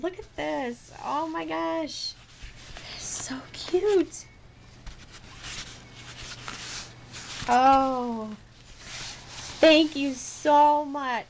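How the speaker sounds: high, slow gush with loud bursts; real excitement. Real, not acted. Vlogger showing off a cute present, really excited. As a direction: marvel at the gift, stretching each exclamation. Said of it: "I'm really excited".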